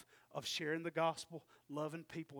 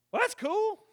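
mock naive; 12 dB louder than usual. Husband's naive reply.

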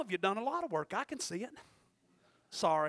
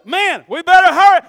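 hammed-up yelp, much higher and louder; mock hurry; 9 semitones higher, 22 dB louder than usual. Churchgoer suddenly rushing out of service, mock yell. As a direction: blurt it like a churchgoer itching to leave. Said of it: "And all of a sudden".

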